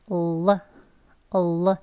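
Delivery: 르 with the tongue tip on the gums, then released; 르 sound. Shown as a demonstration. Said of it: "떼면서 르 합니다". (혀끝을 잇몸에 댔다 떼며 르)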